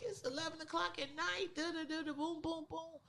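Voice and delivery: high, squeaky mock protest; mock incredulous. Teller mocking her boyfriend, high incredulous squeak. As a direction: pitch it way up in disbelief.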